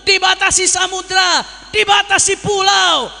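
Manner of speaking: rising pulpit fervour, near shouting; fiery preaching. Acted. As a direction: rouse the crowd like a preacher at a rally. Preacher stressing distance and unity.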